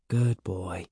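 gentle soft words to a dog. A narrator's reading. Evan soothing his panting dog, softly. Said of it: "Evan said softly".

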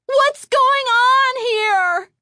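very high, loud, slow frightened cry; alarmed cry. A narrator's reading. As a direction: cry out in disbelief. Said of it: "Chris cried aloud".

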